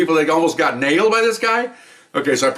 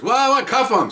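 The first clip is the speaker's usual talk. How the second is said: higher, baffled then curt; puzzled order; 10 semitones higher, slower than usual. Retold or imitated. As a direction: shrug it off and order. Sergeant's puzzled order retold.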